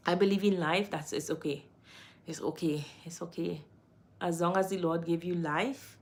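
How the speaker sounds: usual speaking voice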